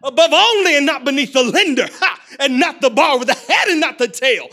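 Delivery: loud, high hyped preacher shout; T D Jakes impression. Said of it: "Y'all like my T D Jakes?".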